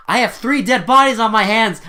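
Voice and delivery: high, very loud imitated outburst; mock exasperated shout. Detective ranting to older colleague about deaths, mock exasperated shout. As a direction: explode like an overwrought detective, hammed-up.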